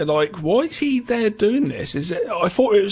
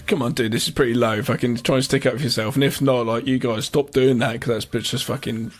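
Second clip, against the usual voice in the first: lower, a hint of an annoyed friend; mildly fed-up inner voice, pitched lower; Dennis's imagined thoughts; 7 semitones lower than usual. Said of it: "he's probably thinking".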